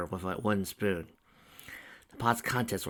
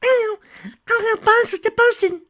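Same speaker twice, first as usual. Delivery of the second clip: put-on tiny squeaky child voice, very high; happy little filly; 13 dB louder than usual. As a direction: chirp it like an eager small foal. Dinky voice.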